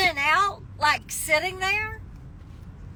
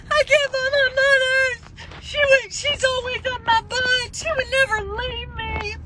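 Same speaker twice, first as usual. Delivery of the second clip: high, tearful pleading to the police; retold sobbing plea, pitched up; crying panic; 8 semitones higher than usual. To a police officer, pleading in a high tearful voice. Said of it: "and I'm crying".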